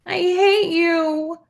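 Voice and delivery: high, slow, sobbed hatred; sobbing. A narrator's reading. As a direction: cry it out through tears. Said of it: "Jamie sobbed".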